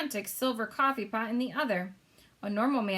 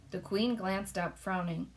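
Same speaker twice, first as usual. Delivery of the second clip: plain reading voice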